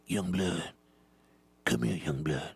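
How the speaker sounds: put-on low, hushed old-timer voice; conspiratorial low old-man voice. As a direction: beckon secretively as an old veteran. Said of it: "The old man".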